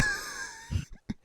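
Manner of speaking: shrieking high laugh. Real, not acted. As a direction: burst into a squeal. Laughing at announcement.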